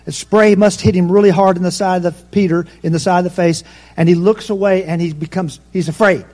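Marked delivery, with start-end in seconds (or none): none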